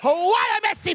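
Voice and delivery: high, loud roaring climax; commentator shout. Pretend. Mock commentator hitting the peak of his call, shouting. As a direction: scream it like the ball just hit the net.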